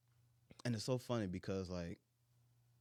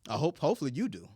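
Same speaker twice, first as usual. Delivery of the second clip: retold comeback, louder and emphatic; indignant retort; 2 semitones higher, 9 dB louder than usual. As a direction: fire back loudly in a retold argument. Retold argument, answering her attitude.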